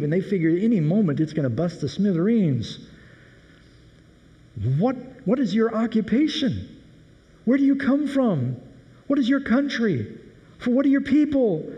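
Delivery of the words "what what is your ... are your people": high, rapid-fire panicked questions; frantic questioning. Acted. Terrified sailors demand answers from Jonah, high and frantic. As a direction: fire questions at him in alarm. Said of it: "They're just firing questions out".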